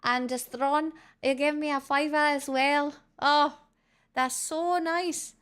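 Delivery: high-pitched put-on Irish accent; hammed-up Irish accent. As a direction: gush thanks in a lilting Irish accent. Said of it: "what an Irish accent".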